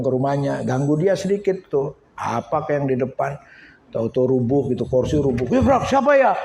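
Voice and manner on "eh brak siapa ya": high, loud call of alarm; mock startled shout. Village grandpa hears his chair crash, mock startled shout. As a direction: call out as if caught off guard.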